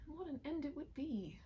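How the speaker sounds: a high, pained cry of anguish; trembling anguish. Acted. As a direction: let the despair break out in the words. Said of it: "Elise trembled in her anguish".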